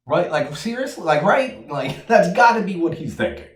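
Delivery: high, loud disbelief breaking into a laugh; incredulous. Real, not acted. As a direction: squeal it in pure disbelief. Incredulous at the twist.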